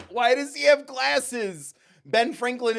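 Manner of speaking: high, loud, drawn-out protest; exaggerated loud incredulity; mock outrage. Real, not acted. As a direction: wail it slowly in disbelief. Mock outrage at character design.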